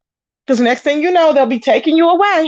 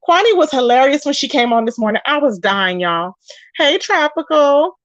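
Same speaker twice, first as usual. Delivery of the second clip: usual speaking voice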